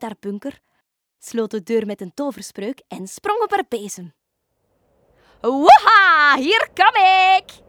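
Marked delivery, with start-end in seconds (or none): 5.4-7.6 high, loud, joyful witch shriek, slowing on the second word; the witch whoops as her broom lifts off; gleeful shriek. A narrator's reading. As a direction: screech it with delight as she takes off. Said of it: "krijste ze vrolijk".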